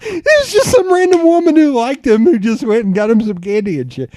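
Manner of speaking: high, loud, laughing delivery; real laughter in the voice; cracking up. Real, not acted. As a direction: blurt the punchline through laughter. Cracking up at own punchline.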